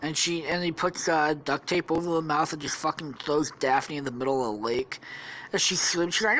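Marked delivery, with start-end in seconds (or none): none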